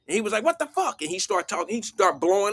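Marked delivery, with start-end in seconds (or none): 0.4-1.0 high-pitched mock what the fuck; imitated angry yell. Homeless man yelling at the father, mock outrage. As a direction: toss it back up high like the stranger did.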